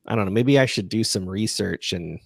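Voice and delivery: ordinary talk, nothing marked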